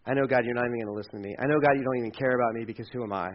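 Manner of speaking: low, subdued, self-doubting plea; unworthy prayer. Acted. Believer talking to God in a desperate spot, low and defeated. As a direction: confess softly as one expecting no answer. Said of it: "when we're at that spot".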